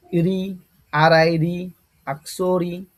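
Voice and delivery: রি drawn out very slowly around the letters R I; slow spelling demo. Shown as a demonstration. Teacher spelling the syllable রি for students, very slow. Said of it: "উচ্চারণটা এরকম হবে".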